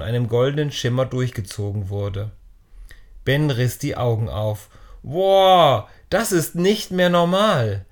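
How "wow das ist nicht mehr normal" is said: high, loud astonished wow; a boy stunned by the glowing stone; amazed exclamation. Acted. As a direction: burst out in disbelief, higher and louder. Ben amazed as light floods in.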